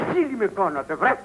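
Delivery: deep-pitched, contained indignation; low angry reproach. Acted. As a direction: keep the accusation down low. Reproach, first low then screamed. (χαμηλόφωνη αγανάκτηση)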